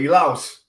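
Elouse said the Italian way, e-LOUSE. Shown as a demonstration. Host naming his guest, Elouse said the Italian way. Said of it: "I say it the Italian way".

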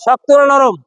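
ordinary talk

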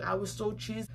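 usual speaking voice

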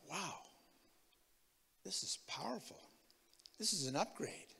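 soft, breathy wonder; almost whisper it in awe; awed hush. Retold or imitated. Believer discovering the New Creation teaching, in an awed hush.

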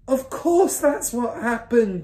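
high, loud, drawn-out exasperation; mother's cry. A narrator's reading. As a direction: cry it out scornfully. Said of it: "the mother cried".

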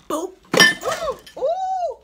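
high loud boop sound effects; punch noises. Retold or imitated. Reenacting punching the friend, high loud boop noises. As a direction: squeal out the punches like a cartoon. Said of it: "fight punches imitated".

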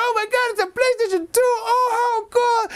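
put-on very high, fast overjoyed child; very high fast squeal of joy; thrilled kid. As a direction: squeal with delight. Boy opens a PlayStation.

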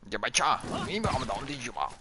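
normal talking voice

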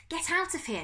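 high, urgent gasped order. Acted. Novak sending Darcus away, gasping urgently. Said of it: "Novak gasped".

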